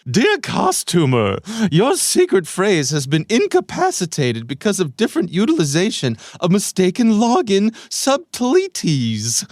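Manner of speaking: high, loud, unhinged reading; crazy voice. Acted. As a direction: read it like a raving maniac. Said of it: "I'm gonna do a crazy voice here".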